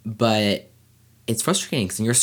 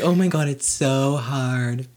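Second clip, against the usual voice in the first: mock melodrama; 5 semitones higher, slower than usual. Mocking the co-host's dramatic line.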